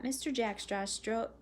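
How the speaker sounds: ordinary delivery, nothing marked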